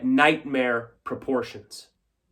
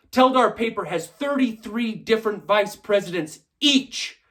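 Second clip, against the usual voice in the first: higher-pitched, heated public accusation; indignant tirade; 5 semitones higher, 3 dB louder than usual. Acted. Shareholder rails at executive perks before stockholders, indignant. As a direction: rail at them with jumps in pitch.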